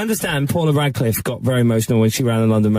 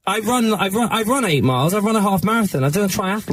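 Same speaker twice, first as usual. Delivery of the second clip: defensive; 8 semitones higher than usual. Real, not acted. Defending himself after shade thrown.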